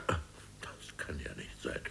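slow unvoiced whisper under the breath. Boss grumbles aside about the call, whispered. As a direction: mutter it to yourself in disbelief, whispered.

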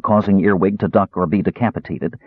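ordinary delivery, nothing marked

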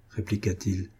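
usual speaking voice

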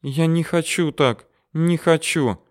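high, plaintive woman's voice; tearful sobbing. A narrator's reading. Mari pleads with Oleg through the bars, tearful. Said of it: "снова прорыдала она".

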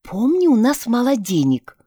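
ordinary delivery, nothing marked

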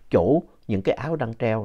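usual speaking voice

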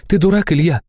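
a hint of an angry girlfriend; Liza, exasperated. A narrator's reading. Liza snaps at Ilya. (Лиза, сердито)

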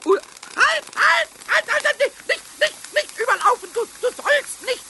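high, loud frantic cries of halt; frantic shouting. Acted. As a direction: yell at the pot to stop. Shouting at the boiling-over milk.

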